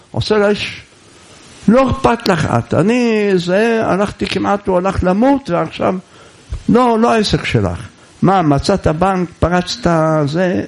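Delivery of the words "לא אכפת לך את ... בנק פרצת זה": higher-pitched acted irritation; mild marital squabble. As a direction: voice a touch of indignation, pitched up. Acted marital quarrel in a story. (כעס קל ומשוחק)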